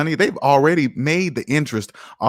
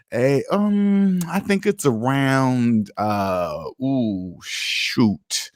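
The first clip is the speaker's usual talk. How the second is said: slow, halting, unsure guess trailing off; clueless stammering guess; much slower than usual. Shown as a demonstration. To show how guessing sounds, a stammering clueless guess. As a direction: fumble for an answer you don't know. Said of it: "That's how it sounds".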